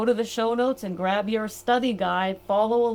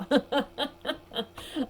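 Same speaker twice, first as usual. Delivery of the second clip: laughing; 6 semitones higher than usual. Real, not acted. Amused at her own joke.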